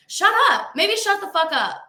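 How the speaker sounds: high, emphatic clapback; irritated retort. Real, not acted. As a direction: fire back at an annoying commenter. Snaps back at the commenter.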